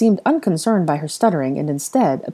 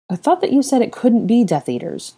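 slight bite; 7 semitones higher than usual. Acted. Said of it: "a slight bite in her tone".

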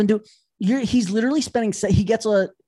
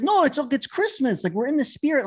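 placating husband; 7 semitones higher than usual. Retold or imitated. Husband placating tugging wife.

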